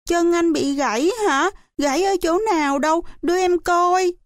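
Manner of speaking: put-on high-pitched child's worried questions; concerned little child. A narrator's reading. Naive child asking about the leg. (giọng em nhỏ lo lắng, cao)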